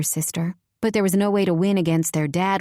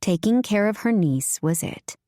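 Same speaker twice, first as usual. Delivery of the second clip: ordinary delivery, nothing marked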